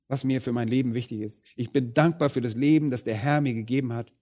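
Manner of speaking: ordinary talk, nothing marked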